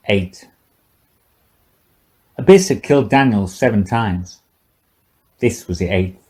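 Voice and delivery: ordinary delivery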